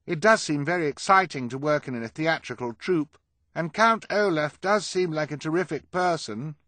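high, loud character voice; put-on high voice. A narrator's reading. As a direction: placate them in a high, put-on voice. Klaus in fake high voice.

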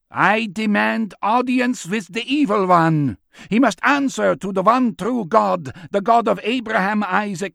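high, forceful prophetic proclamation; thundering demand. Acted. Old man demanding audience with the evil one, thundering. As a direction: declaim it over a hostile crowd.

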